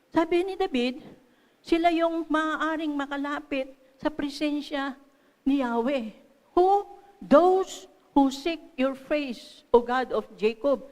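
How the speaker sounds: loud, emphatic scripture proclamation; preaching at full force. Real, not acted. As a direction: drive the verse home loudly.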